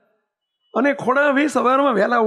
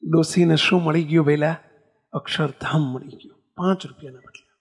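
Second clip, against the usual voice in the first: hushed sermon; 5 semitones lower than usual. Acted. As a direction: let it sink in gently. Preacher's hushed moral.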